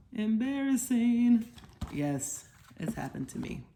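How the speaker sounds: usual speaking voice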